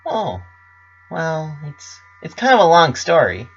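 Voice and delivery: bubbly pony girl, lightly voiced. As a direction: brighten up into Pinkie's chipper tone. Pinkie answers her friends.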